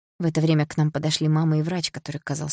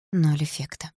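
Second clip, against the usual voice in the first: ordinary delivery, nothing marked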